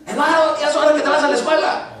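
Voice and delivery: high, loud wake-up call; mother yelling. Retold or imitated. Preacher imitating his mother waking him for school, loud and high. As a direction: shout him out of bed like an army mom. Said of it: "ese era mi despertador".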